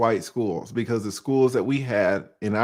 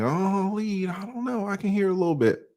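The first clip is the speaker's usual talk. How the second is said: higher, softer teasing mock viewer voice; imitating viewers; 7 semitones higher, 4 dB quieter than usual. Vlogger lightly imitating viewers who hear his accent. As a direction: lightly mimic a doubtful viewer. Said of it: "Some of y'all are like".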